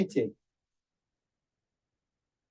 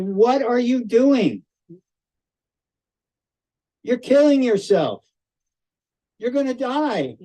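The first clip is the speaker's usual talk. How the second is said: higher, blunt doctor scolding a patient; 7 semitones higher than usual. Retold or imitated. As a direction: tell him straight, no softening. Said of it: "She doesn't mince any words".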